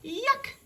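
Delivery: squealed yuck at sour milk; disgusted yuck. Acted. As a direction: recoil in disgust.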